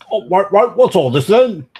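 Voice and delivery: put-on high, loud British copper accent; British accent, a stock London bobby. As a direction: demand it like a British constable. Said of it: "doing this with a British accent".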